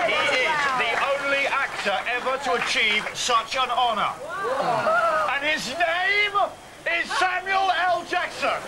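high, loud showman's introduction, building up to the name; grand announcement. Acted. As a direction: announce the guest like a triumphant MC, slowing on the name. Radio host's grand announcement of guest.

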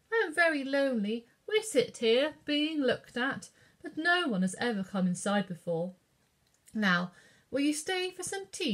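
gracious French hostess offering tea; French lady. A narrator's reading. Madame offers Katie tea in a French accent.

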